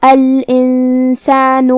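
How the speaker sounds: الانسان with the noon hidden and nasalised; slow, drawn-out الانسان with a nasal hum on the noon; ikhfa with ghunna. Shown as a demonstration. Teacher shows hidden noon with nasal hum in الانسان. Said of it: "نون ساکن کو چھپاتے ہوئے اور غنہ کے ساتھ".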